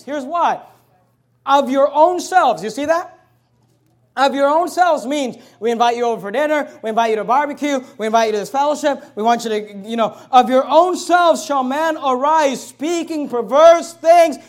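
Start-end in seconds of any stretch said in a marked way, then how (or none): 1.5-2.6 Of your own selves slow and loud, own leaned on; stress on own selves. Real, not acted. Pastor pointing his church to a key phrase, slow and loud. 10.3-14.4 slow, loud scripture proclaimed; loud preaching. Real, not acted. Pastor rereading the warning to his church, slow and loud.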